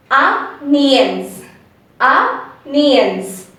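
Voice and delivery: "onions" as "ah-nyanz", in two separated parts; corrected pronunciation. Shown as a demonstration. Teacher models the word for learners, carefully corrected pronunciation. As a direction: say "onions" with an open "ah", then "nyanz". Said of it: "the actual correct pronunciation is".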